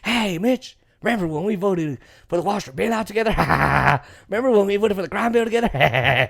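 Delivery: glad-hand old pals in a put-on voice, chuckling; hammed-up backroom reminiscing with laughs; Joe Biden impression. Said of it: "he went behind closed door".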